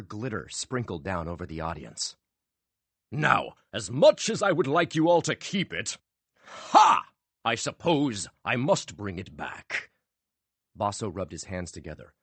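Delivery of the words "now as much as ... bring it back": high showy voice with a sharp ha; teasing showman. A narrator's reading. Magician teasing the audience, showy. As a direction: tease them, then relent.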